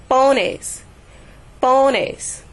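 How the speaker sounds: "pones" with PO high and strong, falling on "nes"; stress on first syllable. Shown as a demonstration. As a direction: hit the "po" of "pones". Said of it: "the stress is put on the po".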